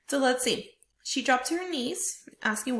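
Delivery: ordinary talk, nothing marked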